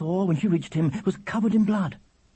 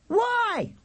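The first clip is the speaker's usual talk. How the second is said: put-on very high child's yell; top-of-voice shout. A narrator's reading. Charlie interrupted in his singing, shouting. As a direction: holler it at an extremely high pitch. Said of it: "shouted Charlie at the top of his voice".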